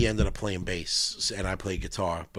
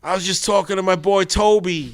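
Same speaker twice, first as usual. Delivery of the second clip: high, louder hardcore-singer voice; 9 semitones higher, 7 dB louder than usual. Retold or imitated. Recruiting [name] for the band, in a high, louder tough-guy voice. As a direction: pump it up like a hyped New York frontman.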